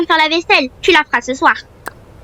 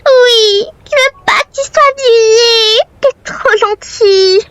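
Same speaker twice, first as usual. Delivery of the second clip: tearful; 7 semitones higher, slower than usual. Acted. Friend fears roommate getting fired.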